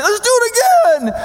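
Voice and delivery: gleeful villain line, the voice cracking upward. Real, not acted. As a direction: let the voice break high on again. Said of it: "sorry about the voice crack".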